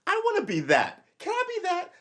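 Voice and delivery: thrilled at the idea, falsetto; high squeal. Real, not acted. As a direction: squeak it out with delight. Excited squeal.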